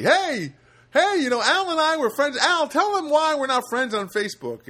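high, loud imitation of a boisterous buddy; mock boisterous yelling; loud calling out. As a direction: holler it to the whole room. Drunk manager telling everybody, loudly.

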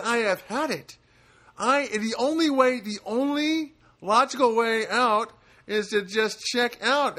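high, loud, slow exasperated husband; fed-up husband. Retold or imitated. Husband explaining why he quit life, high exasperated whine. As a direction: voice the husband at the end of his rope. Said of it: "He's just said".

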